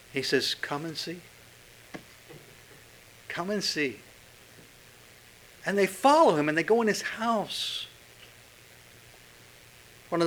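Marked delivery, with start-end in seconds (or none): 0.5-4.0 gentle invitation. Acted. Jesus inviting, quiet repeated call.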